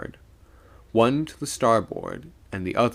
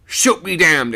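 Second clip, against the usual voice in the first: loud, raised angry exclamation; exclaiming; 8 semitones higher, 9 dB louder than usual. A narrator's reading. Old man brushing off the mate's plea, exclaiming. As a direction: dismiss it with a shout. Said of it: "exclaimed the old man".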